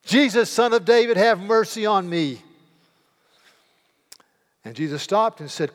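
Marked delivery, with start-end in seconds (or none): 0.0-2.4 even louder, higher desperate cry. A narrator's reading. Beggar crying out again over the rebuking crowd, loud. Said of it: "He cried all the more".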